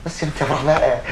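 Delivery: usual speaking voice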